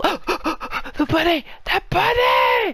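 gasping, then a high shout of the bunny; startled delight, the voice jumping way up; excited gasp. Real, not acted. Player spots the bunny in the game, excited high shout.

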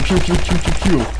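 rapid pew-pew shooting noises; imitated gunfire. Player shooting at bunnies, spoken gun noises. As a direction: fire off toy blaster sounds.

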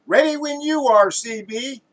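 high, loud ready call, easing at the end; cameraman calling out. Acted. Third cameraman to director, eagerly calling out. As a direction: sing out that you're all set.

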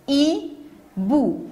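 "hibou" in two separated beats; short pause inside "hibou"; syllable by syllable. Shown as a demonstration. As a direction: say i... bou for "hibou". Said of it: "Deux syllabes".

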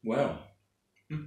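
talking between bites; chewing. Real, not acted. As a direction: mouth full of noodles. Seen 2: Eats noodles from box, fork at mouth while saying "wow, hmm.

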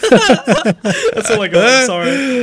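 helpless giggles breaking through the words; cracking up while trying to apologise; giggling. Real, not acted. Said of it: "I'm just giggling at your funny accent".

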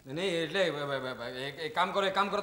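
soft, stumbling start on the phone; stammer, then get the instruction out; flustered stammering. Acted. On the phone telling someone to wait, flustered stammer.

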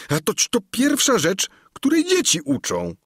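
can't believe his master doesn't know; astonished. A narrator's reading.

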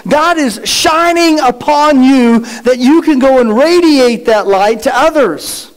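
ordinary talk

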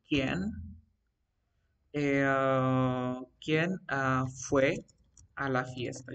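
word by word, with long pauses; halting dictation. Shown as a demonstration. Teacher composes an example about the party, halting dictation. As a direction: feel out the sentence slowly as if writing it.

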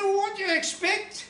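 a high, loud, nagging wife voice; high mock scolding wife. Seaside puppet wife scolding her husband, high and loud.

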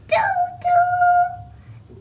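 high, loud sung toot toot; cheerful honking horn; bus horn toot. Acted. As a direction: sing it like a little bus horn. Bus horn sound effect.

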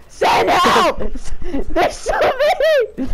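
frantic high shout for help; panicked shouting. Real, not acted. Swarmed by zombies, calling out.

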